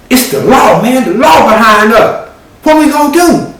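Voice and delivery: cousin's alarm. Acted. Cousin seeing the police behind them, loud high alarm. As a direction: panic about the law behind them.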